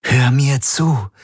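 slow, high, insistent; urgent appeal. A narrator's reading. Woman trying to reach her panicking friend, urgent. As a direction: make him listen, slowly and intently. She pleads with the panicking man.

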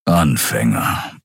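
low, slow growled word; grumbling Keru. A narrator's reading. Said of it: "brummte Keru". (tief und langsam gebrummt)

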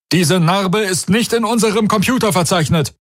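high-pitched, urgent report; crewmember reporting. Acted. Crewmember reports an unknown scar, high-pitched urgency.

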